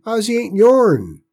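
put-on high, loud upset boy; protesting boy. A narrator's reading. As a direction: cry out in defiance. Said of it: "he protested".

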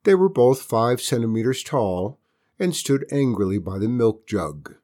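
ordinary delivery

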